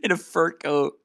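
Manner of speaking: high-pitched amused disbelief, broken by laughter; real giggly incredulity; amused, laughing. Real, not acted. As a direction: laugh through the words, voice climbing. Laughing at photo he found.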